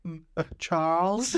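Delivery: high, slow, tentative question; imitated worried woman; mock timid concern. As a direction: check on him in a small hesitant voice. Acting woman tentatively checking on attacker.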